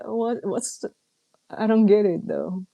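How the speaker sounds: ordinary talk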